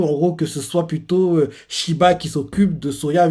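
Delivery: ordinary talk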